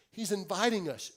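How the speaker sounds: ordinary delivery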